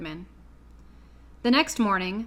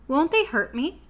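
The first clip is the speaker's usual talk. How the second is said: anxious; 6 semitones higher than usual. A narrator's reading. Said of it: "asked the girl anxiously".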